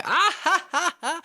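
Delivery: high loud honking laugh; mock braying laugh. Mocking a man laughing at Kevin Hart, loud braying laugh. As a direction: bray like the annoying fan. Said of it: "he'd go".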